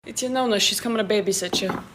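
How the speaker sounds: ordinary talk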